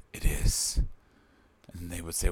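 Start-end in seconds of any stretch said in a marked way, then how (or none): 0.1-0.8 unvoiced, menacing villain whisper. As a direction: hiss it like an action movie bad guy. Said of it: "that menacing voice".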